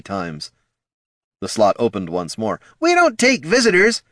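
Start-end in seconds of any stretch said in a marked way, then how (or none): 2.8-4.0 high, loud sneering voice; snooty guard. Acted. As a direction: brush them off rudely. Guard refuses.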